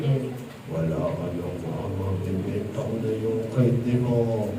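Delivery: very low, slow taunting voice; slow deep villain sneer; mock villain. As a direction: gloat like a kidnapper. Satan taunting the child.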